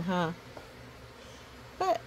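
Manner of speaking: plain reading voice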